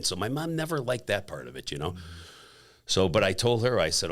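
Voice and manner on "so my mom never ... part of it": normal talking voice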